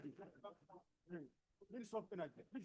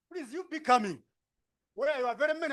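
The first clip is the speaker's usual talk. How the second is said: loud, higher call across the room; 7 semitones higher, 9 dB louder than usual. Real, not acted. Facilitator calling a scattered group over, loud. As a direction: raise your voice to reach the back.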